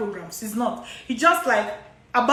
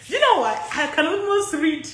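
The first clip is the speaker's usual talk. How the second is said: normal talking voice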